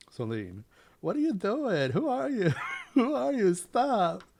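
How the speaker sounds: high, loud panicked screaming; terrified scream. Acted. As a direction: scream in terror, voice breaking high. Victim screams at approaching killer.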